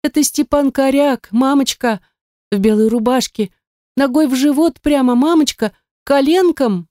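put-on high, eager little girl; a child reporting the fight to mother; little girl's voice. A narrator's reading. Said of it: "Лялин голосок точит".

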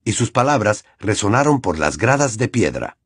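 usual speaking voice

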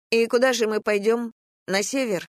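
put-on high child's voice, questioning; young boy. A narrator's reading. Narrator voices young nephew questioning.

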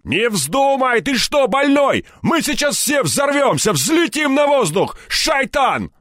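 high, frantic shouts of protest; panicked yelling. A narrator's reading. Thugs begging the hero not to ignite fuel, panicked yelling. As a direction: yell in terror to stop him. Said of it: "заорали они разом".

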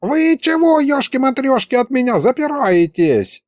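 put-on high, hurt squeal of an old wizard; the old wizard takes offense at the grandpa, squealing high; offended old wizard. A narrator's reading.

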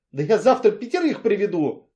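shouting. A narrator's reading. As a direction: throw it at him loudly. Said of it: "крикнула Алевтина".

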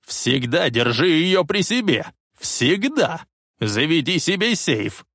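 put-on old man hammering instructions into his grandson; urgent commands. A narrator's reading. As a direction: drill it into him.

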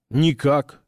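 plain reading voice

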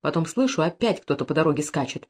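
ordinary delivery, nothing marked

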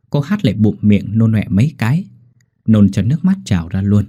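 usual speaking voice

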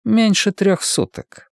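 high, slow correction; pedantic. A narrator's reading. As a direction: correct the figure with slow precision. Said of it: "педантично уточнил Гладстон". (педантично уточняя)

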